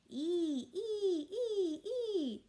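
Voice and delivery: put-on high squealing baby shrieks; baby shrieking. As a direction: squeal like a delighted toddler. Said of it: "Tootsie shrieked".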